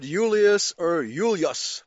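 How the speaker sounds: "Julius" with a Y, first three syllables, then two; Y pronunciation. Shown as a demonstration. Teacher showing how Latin sounded, "Julius" begun on Y both times, slowly. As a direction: say "Julius" as YOO-lee-us, then YOOL-yus. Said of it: "as if you said".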